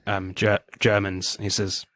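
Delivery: usual speaking voice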